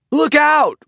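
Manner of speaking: high, loud call of alarm; warning shout. A narrator's reading. Stripes to the racers, a loud high warning. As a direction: shout to warn them. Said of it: "called Stripes".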